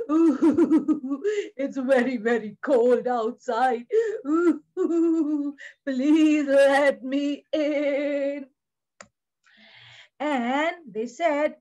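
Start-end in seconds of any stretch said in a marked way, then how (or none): 0.1-8.4 pleading bear. Acted. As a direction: beg as a big cold bear, drawing out the plea. Bear begs to come inside.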